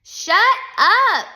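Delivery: loud, high-pitched yell; exasperated teacher's shout. A narrator's reading. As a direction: yell it, punching both words. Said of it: "Missus Jules yelled a third time".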